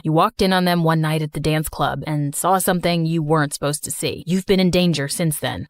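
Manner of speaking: put-on deep, steady young man; calm her down in a low voice; low reassuring male voice. Slater explains.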